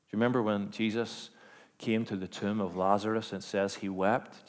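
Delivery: ordinary talk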